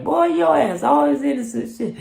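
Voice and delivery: very high, loud scolding; imitating the homie's mama. The homie's mama scolding him, very high.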